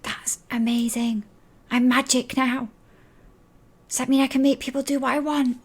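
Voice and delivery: put-on high, hushed, excited child; giggly secret in a high half-whisper. Child upstairs hiding magic from auntie, high excited whisper. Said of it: "as if she's forgotten to whisper".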